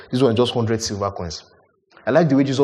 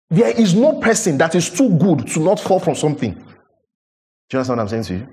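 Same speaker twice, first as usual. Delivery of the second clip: ordinary talk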